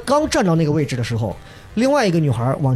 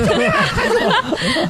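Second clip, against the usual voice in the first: much higher, slow, laughing through a retold scream for help; laughing mock yell; slower than usual. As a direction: laugh while hamming up the yell. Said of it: "我也喊得更凶".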